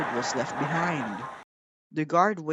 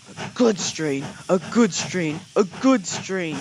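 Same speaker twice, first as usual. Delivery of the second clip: grumbling; 4 semitones higher, 6 dB louder than usual. A narrator's reading. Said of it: "he grumbled".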